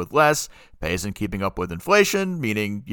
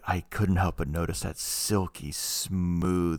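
sexy voice; 4 semitones lower, 6 dB quieter than usual. Pretend. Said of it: "you gotta get more innuendo in it".

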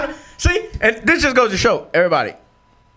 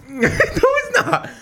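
laughing; much slower than usual. Real, not acted. Laughing denial.

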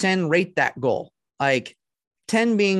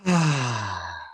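slow exhale through the mouth; long breath out; 5 semitones lower than usual. Real, not acted. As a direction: let the breath go.

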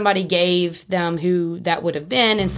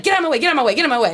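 higher, repeated push through a crowd; insistent, raised-pitch retelling; retold urgent order; 6 semitones higher, 3 dB louder, much faster than usual. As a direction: re-enact clearing people aside urgently. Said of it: "And I'm like".

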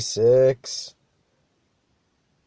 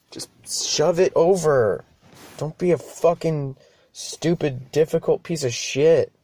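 higher, louder exasperated rant; mock rant; 6 semitones higher, 3 dB louder than usual. Driver rants at an imagined passenger, mock angry. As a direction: vent like you're snapping at a passenger.

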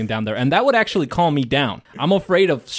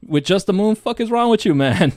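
incredulous; 7 semitones higher, 4 dB louder than usual. Real, not acted. Incredulous rant.

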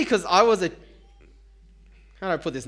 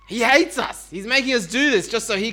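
louder, higher accusing cry; hammed-up cry of the grumblers; mock outraged complaint; 5 semitones higher, 5 dB louder than usual. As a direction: throw the blame at God. Voicing their view of God.

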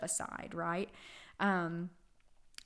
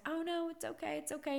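much higher, softer cooing reassurance; soothing baby; 9 semitones higher, 3 dB quieter, faster than usual. Retold or imitated. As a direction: coo to calm a startled baby. Mother comforts upset baby.

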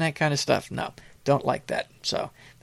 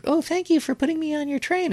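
much higher, sing-song mock of a grateful fan; mocking gushing follower; 13 semitones higher, faster than usual. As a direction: parody the sugary thank-you reply in a high voice.

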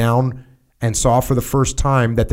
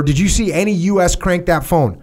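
higher-pitched, pressing officer's demand; angry superior; 7 semitones higher than usual. A narrator's reading. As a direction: read the colonel's anger pitched up. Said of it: "immediately began screaming".

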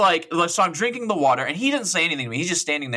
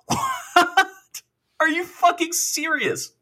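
high-pitched, drawn-out disbelief; stunned, high-pitched protest; incredulous outburst; 12 semitones higher, slower than usual. Retold or imitated. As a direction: recreate a squeal of utter disbelief. Acting out disbelief at jail threat.

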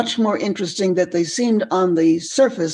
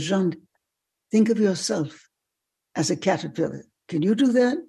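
put-on French accent. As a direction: invite a pupil in a French accent. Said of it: "in a French accent".

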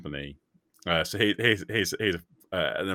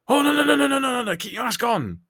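flustered staff member; 5 dB louder than usual. Retold or imitated. Retold staff member panicking.

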